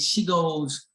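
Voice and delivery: usual speaking voice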